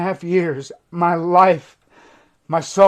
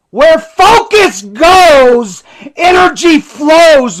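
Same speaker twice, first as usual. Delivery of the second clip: sobbing shout, much higher and louder, slow; real emotional outburst; crying shout; 10 semitones higher, 13 dB louder, much slower than usual. Real, not acted. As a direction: cry it out through tears at full voice. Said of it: "I'm sorry that I'm getting emotional".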